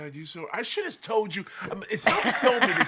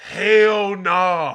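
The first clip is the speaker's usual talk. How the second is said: loud, slightly raised mock outburst; emphatic refusal; 4 semitones higher, 7 dB louder than usual. Mom answering her son's request, emphatically. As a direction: belt out a retold refusal. Acted retort in a retold exchange.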